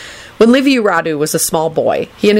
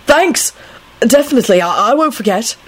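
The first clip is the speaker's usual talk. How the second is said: high, stumbling eager voice; flustered young man; 6 semitones higher than usual. A narrator's reading. Young man promises his senior, flustered and eager.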